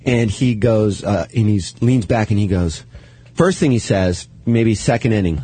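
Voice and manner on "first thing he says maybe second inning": ordinary talk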